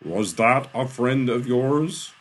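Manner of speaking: booming question. A narrator's reading. Eden questions Shaw from empty air, loud and imperious. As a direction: boom it out slowly, rising.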